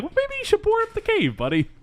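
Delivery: very high, quick mock exasperation; exasperated retort. Viewer scolding the hero about the cave, high quick exasperation. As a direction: squeal the advice at a clueless hero. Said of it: "And I'm like".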